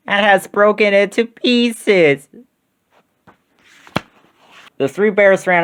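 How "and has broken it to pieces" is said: high, slow little cub's lament; wee little voice. A narrator's reading. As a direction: stretch it out in a tiny high bear voice. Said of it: "in his wee little voice".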